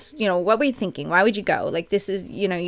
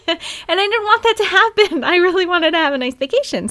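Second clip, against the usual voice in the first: laughing through the words, higher and louder; 11 semitones higher, 6 dB louder than usual. Real, not acted. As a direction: giggle it out, amused at herself. Laughing at her own gloom.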